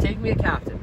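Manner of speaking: ordinary delivery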